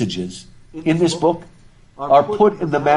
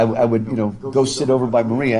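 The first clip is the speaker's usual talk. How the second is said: ordinary talk, nothing marked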